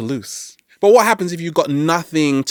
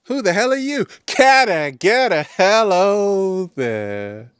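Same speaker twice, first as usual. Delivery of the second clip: mock confused call; 6 semitones higher, slower than usual. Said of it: "I was like".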